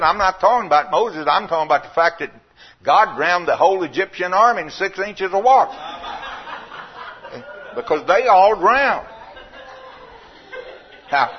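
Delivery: loud, high preaching voice; full-force preaching. Acted.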